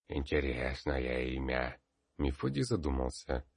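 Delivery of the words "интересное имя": put-on old man speaking with a grunt; creaky old peasant, grunting; groaning old man. A narrator's reading.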